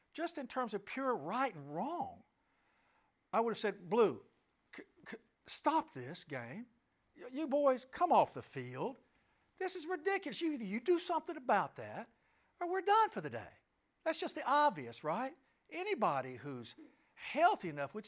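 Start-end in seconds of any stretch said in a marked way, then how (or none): 3.9-13.5 indignant protest. Pretend. Imagined stopping the game, indignant.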